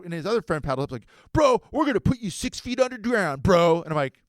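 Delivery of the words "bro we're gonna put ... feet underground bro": high, loud surf bro yell; hammed-up shouted threat, pitched way up; mock death threat. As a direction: threaten like a hyped-up sidekick. Acted threat from surfer's friend.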